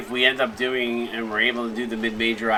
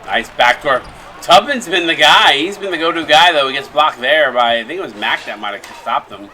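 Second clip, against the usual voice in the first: live play-by-play; 5 dB louder than usual. Real, not acted. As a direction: call the play as it happens.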